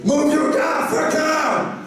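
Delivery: high-pitched road-rage yell; angry driver's shout. Acted. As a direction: shout out of a car window at the driver ahead. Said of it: "put slowly his head in".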